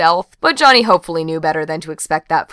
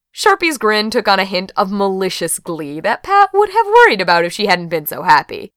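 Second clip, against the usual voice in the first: usual speaking voice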